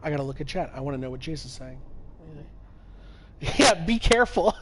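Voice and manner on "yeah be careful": laughing. Real, not acted. Reacting to chat.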